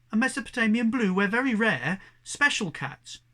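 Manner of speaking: high, loud blurted boast; proud boast. A narrator's reading. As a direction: puff up and announce it. Young cat insisting proudly.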